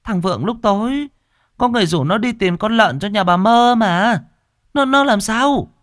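high, anxious pleading questions, loud at the end; a mother begging to know, voice climbing; frantic worry. Acted. Mother rushing out asking about her son, frantic.

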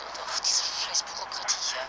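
low, half-voiced grumble; muttered curse. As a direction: grumble it under your breath. Genervter Seufzer über Bürokratie. (leises, genervtes Murren)

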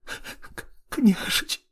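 choked, breathless sobbing whisper; tearful whisper. A narrator's reading. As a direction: whisper through tears. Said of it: "захлёбываясь слезами, прошептала Полина".

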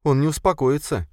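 high-voiced, sniffling worry; mild tearfulness, pitched high; tearful. A narrator's reading. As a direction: say it close to tears. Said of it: "всхлипнула она".

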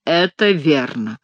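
slow, hollow confirmation; muffled ghostly agreement. A narrator's reading. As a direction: confirm it slowly in a dull voice. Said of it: "глухо подтвердил голос".

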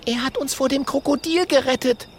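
put-on high girlish voice; porcupine girl voice. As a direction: speak up earnestly. Mathilda voiced.

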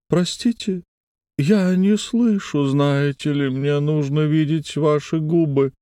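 high, slow, reedy insinuating voice; a wheedling spirit whining on a wire; thin wheedling voice. A narrator's reading. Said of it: "Этот голос вкрадчивый и тонкий".

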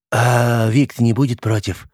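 slow, slushy sh for s; lisping thug butting in. Acted. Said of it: "снова встрял Шепелявый".

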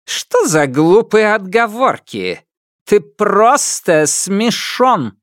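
put-on high, slow scornful old queen; scornful empress. A narrator's reading. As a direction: scoff at him contemptuously. Empress scoffs.

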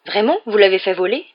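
loud, eager question; keenly interested. A narrator's reading. Father curious about the flying car, keenly interested. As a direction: ask with undisguised enthusiasm. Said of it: "très intéressé".

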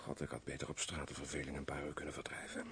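very soft, low grumble to oneself; muttered aside. Acted. Major alone, bored in the castle, muttered aside. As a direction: grumble under your breath.

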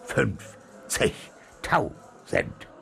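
halting, one syllable at a time; stammering. A narrator's reading. Captain holds the reward money, dumbstruck stammering. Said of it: "stammelte er".

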